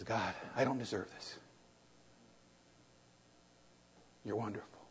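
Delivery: very soft, low hushed thanks; humble prayer. Retold or imitated. Preacher retelling his thanks to God, very soft and hushed. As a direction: murmur it as a grateful prayer.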